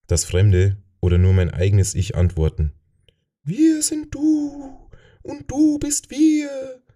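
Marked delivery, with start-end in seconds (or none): 3.4-6.8 hohes, langsames Flüstern einer fremden Macht; fremdes Wesen. Acted. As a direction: wie ein körperloses Kollektiv aus dem Nebel flüstern. Das Fremde antwortet. (hohe, langsame, geflüsterte Stimme eines fremden Wesens)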